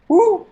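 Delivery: sudden high loud whoop; bid sound. Shown as a demonstration. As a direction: let out a sharp whoop. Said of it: "the bid sound".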